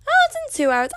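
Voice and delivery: high, squeaky sudden alarm; mock panic. Mock panic at practice being soon.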